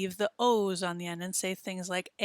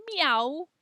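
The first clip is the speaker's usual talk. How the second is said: cat meow; 7 semitones higher than usual. Shown as a demonstration. Playful cat sound.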